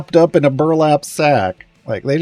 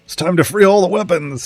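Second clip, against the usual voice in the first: rallying Sortle; 7 semitones higher than usual. Retold or imitated.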